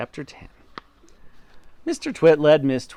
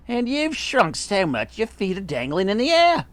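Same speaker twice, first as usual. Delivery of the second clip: higher-pitched gloating cry; husband crying out; 8 semitones higher than usual. A narrator's reading. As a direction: crow at her, pitch lifted. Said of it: "he cried".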